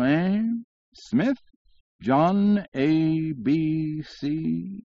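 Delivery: high, extremely slow comic drawl; drawling syllables. A narrator's reading. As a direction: sing out each syllable at great length. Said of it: "drawling out his syllables".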